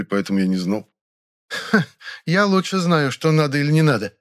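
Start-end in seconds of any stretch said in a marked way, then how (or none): 1.5-4.2 chuckling. A narrator's reading. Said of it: "хохотнула злая Фуфа".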